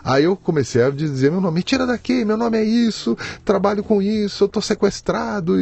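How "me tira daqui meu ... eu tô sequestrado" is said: high, sustained cry for help; retold desperate shouting. Hostage shouting his identity to police from the trunk, desperate. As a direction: re-enact pleading at a high pitch. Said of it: "E comecei a gritar".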